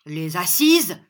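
loud, scornful echo; forceful exclamation; shouted retort. A narrator's reading. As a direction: cry it back in disbelief. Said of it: "cria John".

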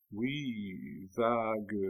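répéter doucement, comme machinalement; réponse absente, un peu étouffée; répétition machinale. A narrator's reading. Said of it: "répéta machinalement André". (d'un ton machinal)

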